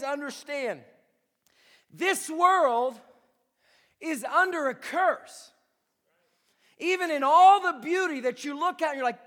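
loud, rising, slow preaching with pauses; preaching at full force. Acted. Pastor to congregation about the curse, fiery preaching. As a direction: build the congregation up to a shout.

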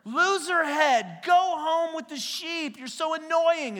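scornful big brothers jeering in a high, slow voice; mocking big brothers. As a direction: taunt the little brother. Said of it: "his brothers are like".

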